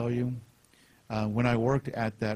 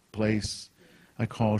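usual speaking voice

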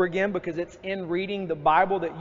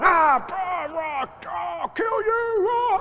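retold yelling at kids; 12 semitones higher, much slower than usual. Father yelling at his kids over a fight, high furious shout. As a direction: scream it at the kids like you've lost it. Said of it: "And I'm yelling".

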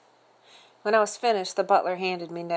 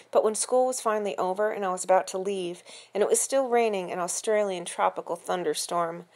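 plain reading voice

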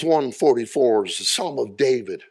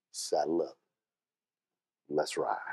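quiet resolve; 8 semitones lower, 10 dB quieter than usual. Acted. Gideon's quiet command to ride.

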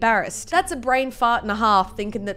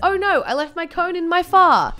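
higher, panicky blurt; mock dismay; 6 semitones higher, 4 dB louder than usual. Person realising the phone is left behind, mock dismay. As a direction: cry it out in mock alarm.

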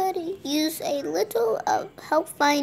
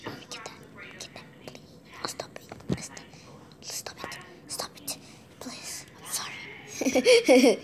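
whispered pleading, very soft; begging whisper; 9 dB quieter than usual. Pretend. Begging the other player to stop, in a whisper. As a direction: plead under your breath.

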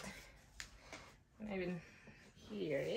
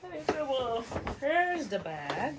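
laughing; 5 semitones higher, 6 dB louder than usual. Real, not acted. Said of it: "What am I gonna do?".

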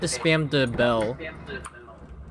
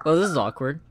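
normal talking voice

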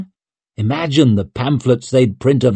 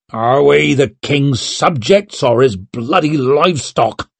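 snapping; 4 semitones higher than usual. A narrator's reading. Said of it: "snapped Mustard".

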